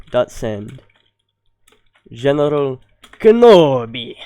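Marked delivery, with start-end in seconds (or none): 2.1-4.1 hammed-up movie accent, Kenobi burst out much louder; best movie accent attempt. Shown as a demonstration. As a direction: ham up the famous movie line in a put-on accent, ending in a loud burst. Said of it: "That was my best accent".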